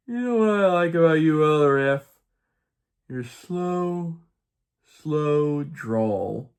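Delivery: high, loud start sliding into a drawl; sleepy drawl. Retold or imitated. Sleepy missionary to Elder F at bedtime, high mock drawl. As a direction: pause long after each drawn-out "slow". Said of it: "I was kinda sleepy".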